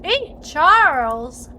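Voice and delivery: calling out. Pretend. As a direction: call across the room to Charles. Calling out to character.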